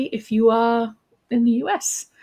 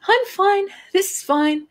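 high, strained insistence; acted rising panic; panicked self-reassurance; 12 semitones higher, slower than usual. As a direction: insist in a high voice, fooling nobody. Panicking man reassures himself.

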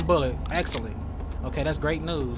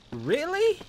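sharp, high disbelieving "really"; surprised; 10 semitones higher than usual. Real, not acted. Hearing the victim's organs were removed, high surprise. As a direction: jump up in pitch in disbelief.

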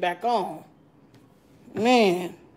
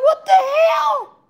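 shocked outcry; slower than usual. Retold or imitated. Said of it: "You be like".

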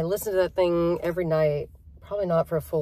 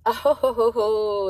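imitated laugh; 4 semitones higher, 4 dB louder, slower than usual. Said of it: "and she laughed".

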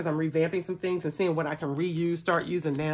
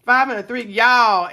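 louder, thrilled call-out; still excited; 3 semitones higher, 8 dB louder than usual. Real, not acted. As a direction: call out the roll with leftover thrill. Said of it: "I get so excited".

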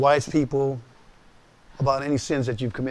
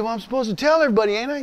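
whiny Marine; 7 semitones higher, faster than usual. Retold or imitated. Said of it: "He said".

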